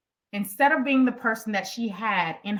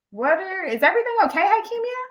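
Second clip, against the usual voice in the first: much higher, concerned voice rising on Hakimia; worried coworker; 10 semitones higher, faster than usual. Retold or imitated. Coworker checking on Hakimia, high and worried. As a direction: sound alarmed and caring, pitched way up.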